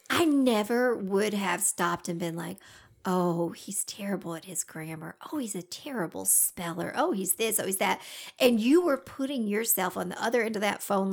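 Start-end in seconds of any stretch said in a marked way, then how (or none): 3.0-8.0 hushed, dropped-voice tutting to oneself; mock judging aside. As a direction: murmur a list of pretend complaints, softly. Imagined judging she never did.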